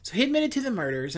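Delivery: fast, back on track after blanking; quick restart. Real, not acted. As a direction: rush through the restarted line. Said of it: "sorry, I lost my place".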